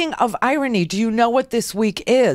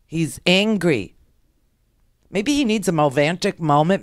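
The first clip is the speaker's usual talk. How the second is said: normal talking voice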